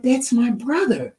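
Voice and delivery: a man realizing it's his brother; amazed. Retold or imitated. Stranger tells the mother he knows the father, amazed. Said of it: "He was like".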